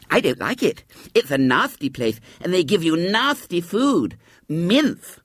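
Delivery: put-on high, lisping child's complaint; petulant little girl Violet Elizabeth. A narrator's reading. Narrator voices Violet Elizabeth.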